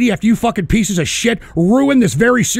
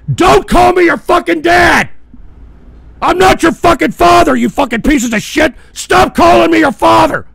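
screaming, much higher and louder; furious shout; 8 semitones higher, 7 dB louder than usual. Real, not acted. Streamer screaming at chat for calling him dad, furious. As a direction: blow up at the chat, full rage.

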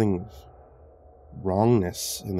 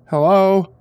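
high, loud, tentative call; venturing call; 11 semitones higher, 9 dB louder than usual. A narrator's reading. Visitor calls into the empty room, venturing. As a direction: call out to see who is there. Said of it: "I ventured".

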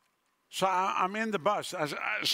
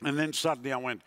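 ordinary delivery

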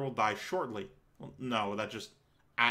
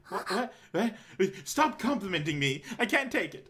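mock flustered; 7 semitones higher than usual.

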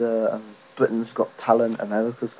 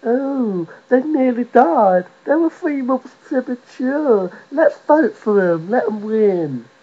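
high-pitched, slow, gushing mock pity; mock sympathetic public; 11 semitones higher, slower than usual. Comic mimics soppy viewers pitying contestants, high mocking coo. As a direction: whine it slowly in a high, put-on voice. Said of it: "people are gonna go".

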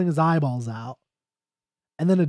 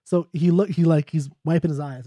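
ordinary talk

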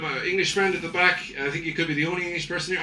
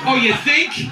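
higher, louder dry sarcasm; mock sarcastic retort; 6 semitones higher, 6 dB louder than usual. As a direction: roll your eyes at them. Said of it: "sarcastic reply to tourists".

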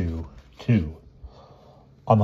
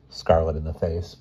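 usual speaking voice

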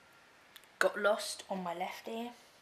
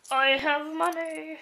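loud slow triumphant shout; shrieking jackpot winner; 8 semitones higher, 12 dB louder, slower than usual. Real, not acted. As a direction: boast like you won big. Money shout.

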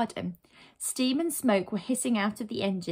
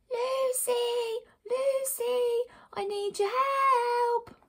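high, drawn-out far-off call; faint cries for help; much slower than usual. Acted. Trapped friend calls heroine from afar, faint high cries. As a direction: wail as if from down the street. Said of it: "the faint cries".

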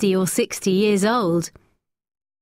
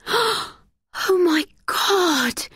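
very slow, high, shocked cry; girl seeing a body on the rocks; horrified exclamation; 11 semitones higher, much slower than usual. A narrator's reading. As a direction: gasp it out in horror at the sight. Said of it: "said Becky".

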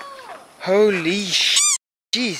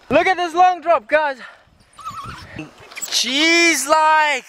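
nervous excitement; 8 semitones higher, slower than usual. Real, not acted. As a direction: gasp at the drop. Looking down long drop.

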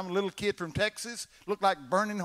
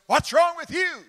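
brother asking; 12 semitones higher, 11 dB louder than usual. Retold or imitated. Crippled brother asks at bedside.

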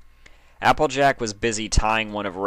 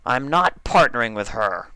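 scowling; 4 semitones higher, 4 dB louder than usual. Acted. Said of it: "Dash repeated, scowling back at him".

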